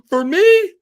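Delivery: can't believe it's for him; shrill delighted gasp of a question; overjoyed surprise. Retold or imitated.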